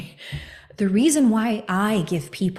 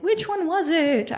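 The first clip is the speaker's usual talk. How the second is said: puzzled question; 10 semitones higher, slower than usual. Retold or imitated. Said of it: "I'm like".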